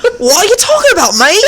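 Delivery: hammed-up British protest, pitched high; mock British accent. Friend with sudden British accent protesting, mock British. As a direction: play a baffled Brit through laughter. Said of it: "started talking with a British accent".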